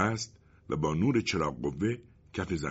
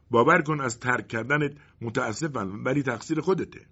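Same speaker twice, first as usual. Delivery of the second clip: higher, reproachful voice of the colonel's shadow; a disappointed companion parting ways; shadow's voice; 7 semitones higher than usual. Acted. The shadow tells the colonel it must leave, higher and reproachful. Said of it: "سایه گفت".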